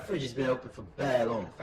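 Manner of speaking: playful roadman telling you off about the fridge; London roadman slang. Pretend. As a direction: hype it up like a London youth. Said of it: "bruv ... fam".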